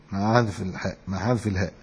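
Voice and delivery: ordinary talk, nothing marked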